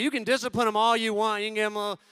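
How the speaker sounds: normal talking voice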